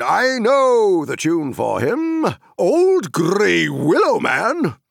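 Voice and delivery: put-on high, loud, hearty old rustic; cheerful booming country voice; jolly Tom Bombadil. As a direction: boom like a merry forest master.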